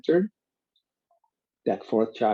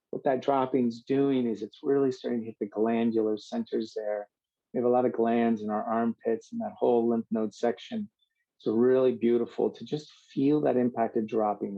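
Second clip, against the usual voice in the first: ordinary talk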